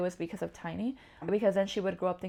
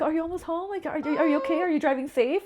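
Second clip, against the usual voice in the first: higher, anxious questions to partner; worried checking in; 9 semitones higher, 5 dB louder than usual. Retold or imitated. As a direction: fret about him driving home safely. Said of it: "I'm always like".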